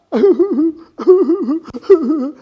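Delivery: loud, high fake wail; mock sobbing. Human upset on a typical day, loud mock sobs. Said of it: "Let's try it again".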